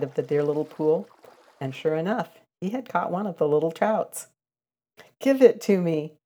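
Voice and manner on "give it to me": a demanding cry, pitched up and louder; cried out. Acted. Reddy Fox crying out to Billy Mink for the trout, loud and demanding. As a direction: snap it out like a greedy fox grabbing for the fish. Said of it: "cried Reddy Fox".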